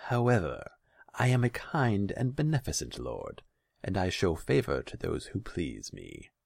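high, lordly magnanimity; softened tone. A narrator's reading. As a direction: ease off into grand graciousness. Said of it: "he softened his tone".

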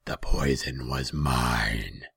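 very slow, loud, gloating demon; heavy, slow creature voice. A narrator's reading. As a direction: draw out the confession menacingly. Demon confesses.

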